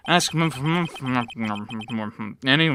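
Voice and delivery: garbled munching through the words; incoherent mouth-full mumbling. Acted. Officer answers while eating the steak lunch, incoherent mumbling. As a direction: mumble as if chewing a big bite. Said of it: "Very coherent".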